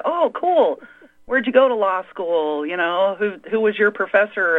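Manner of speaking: high, keen nerdy questioner; eager nerd. Retold or imitated. The nerd quizzing a lawyer about law school, high and eager. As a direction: bounce up high, all eager curiosity. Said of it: "I'm the nerd that comes along and was like".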